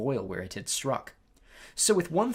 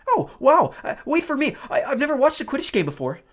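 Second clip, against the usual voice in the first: put-on high, loud thrilled boy voice; 13 semitones higher, 9 dB louder than usual. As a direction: squeal like an excited kid. Colin thrilled.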